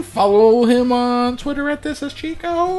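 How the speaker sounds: announcer plug. Acted.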